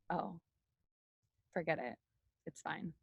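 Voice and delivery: very quiet, low acted sulk; mock hurt withdrawal. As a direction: play wounded, shrinking away with long pauses. Acts hurt shutdown, then says kidding.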